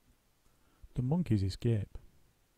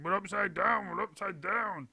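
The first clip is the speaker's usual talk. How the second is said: higher, whiny mock imitation; mocking his wife; 10 semitones higher, 3 dB quieter than usual. Mister Twit mimicking his wife's panic. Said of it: "hopping around shouting".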